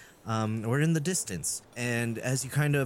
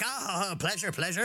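eager dwarf; 5 semitones higher than usual. Acted. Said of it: "It's like".

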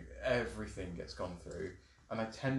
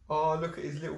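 overwhelmed by cuteness; 6 semitones higher, 7 dB louder than usual. Real, not acted. Gushing over the cat.

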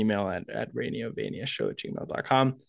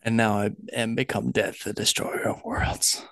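dramatic Oppenheimer quote. Acted. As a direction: intone it like a doomed prophet. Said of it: "most famous J. Robert Oppenheimer quote".

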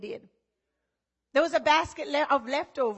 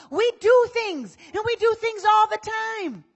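preaching loud; 7 semitones higher, 7 dB louder than usual. Real, not acted. Preacher building the point.

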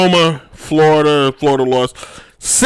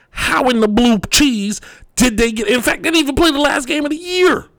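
escalating comedy rant; 6 semitones higher than usual. Real, not acted. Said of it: "I was in full rant mode too".

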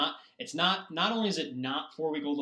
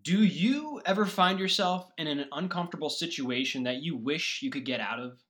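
higher, louder cheesy infomercial pitch; 5 semitones higher, 6 dB louder than usual. Pretend. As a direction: sell it like a late-night TV ad. Fake sponsored ad read.

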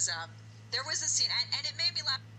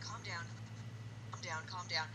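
low, much softer soothing repeat; soothing calm down; 5 semitones lower, 12 dB quieter than usual. Retold or imitated. Therapist on the phone soothing the hysterical woman, low and soft. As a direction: talk her down gently, low and soft. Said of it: "he's like".

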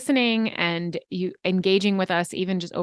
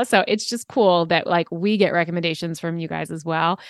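ordinary delivery

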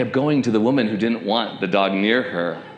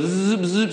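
higher-pitched little zipping buzz; imitated scanner noise; 6 semitones higher than usual. Officer checking the dog for a chip, imitated scanner. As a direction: mimic a handheld clicker scanning. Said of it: "takes her little clicker, and goes".

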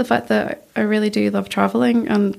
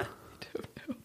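whispered; 23 dB quieter, slower than usual. Real, not acted. Asked why she loves it.

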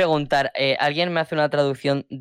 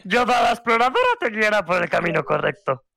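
Yoda voice. Pretend. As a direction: drag each word like wise Yoda. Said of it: "en idioma Yoda". (voz de Yoda, lenta)

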